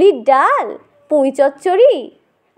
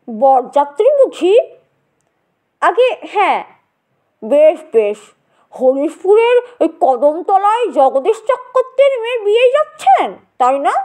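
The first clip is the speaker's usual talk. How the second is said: put-on old gentleman; 3 semitones higher than usual. Old gentleman voiced in story.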